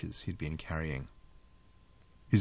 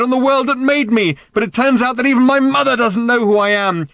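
high, loud, unbroken outburst; anguished cry; 14 semitones higher, 9 dB louder than usual. A narrator's reading. Random, hurt by her mother, crying out loudly. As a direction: cry it out at full pitch. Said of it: "she cried".

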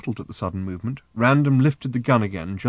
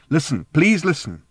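higher, pleading voice; urgent plea; 6 semitones higher, 4 dB louder than usual. A narrator's reading. Trillian to her armed daughter, urgently pleading. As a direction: beg to be heard.

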